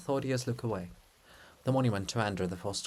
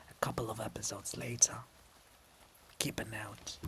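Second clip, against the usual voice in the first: whispered, much softer aside; confiding whisper; 9 dB quieter than usual. Real, not acted. Narrator confiding to listeners, soft whisper. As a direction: let them in on a secret.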